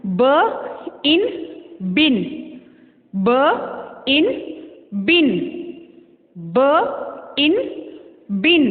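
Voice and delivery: slow, ब and इन pulled apart before बिन; very slow, gapped phonics sounding; separated sounds. Shown as a demonstration. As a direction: sound out ब इन slowly with a gap, then say बिन. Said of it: "separate read करना सीखें".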